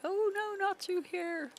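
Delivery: sudden high alarmed cry. Real, not acted. Gamer dreading the trail ahead, high alarmed cry. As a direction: jump up in pitch, dismayed. Sees the dangerous path ahead.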